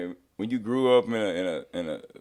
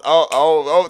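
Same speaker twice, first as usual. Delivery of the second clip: ordinary delivery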